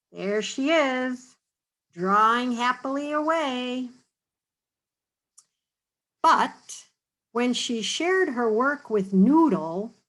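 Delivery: usual speaking voice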